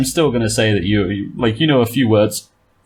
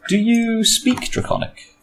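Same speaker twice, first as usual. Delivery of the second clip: high, drawn-out distant call; shouting dragon voice; 13 semitones higher than usual. Acted. Game master voices a voice rolling across the plain, high drawn-out call.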